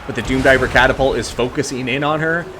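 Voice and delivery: energetic esports caster voice; live play-by-play. Acted. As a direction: call the battle like a live caster.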